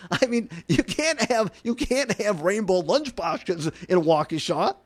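ordinary talk

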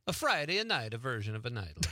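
hammed-up Italian accent on request. Shown as a demonstration. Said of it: "Well, let's hear it".

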